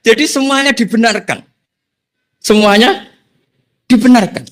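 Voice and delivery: excited laughing. Real, not acted. Preacher to congregation at the punchline, laughing loudly. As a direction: burst out with delight, laughing.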